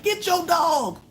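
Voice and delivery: high, repeated frantic yell; hollering. Retold or imitated. Hollering at the neighbor to get her dog, high yell. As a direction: holler it like a crazy lady. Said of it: "hollering and screaming like a crazy lady".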